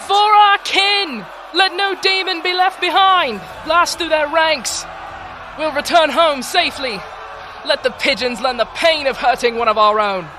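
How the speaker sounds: high, loud shouted rallying calls; battle cries. Acted. Chieftain roaring battle cries to her soldiers. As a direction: roar the troops forward.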